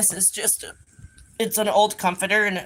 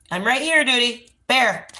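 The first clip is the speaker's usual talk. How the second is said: louder calls, pitch up; raised calling voice; calling the dog; 6 semitones higher, 6 dB louder, slower than usual. Real, not acted. Calling deaf blind dog.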